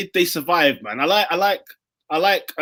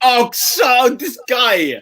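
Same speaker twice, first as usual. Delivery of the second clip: excited outburst; 5 semitones higher, much slower than usual. Real, not acted. Reacting to worst hat trick.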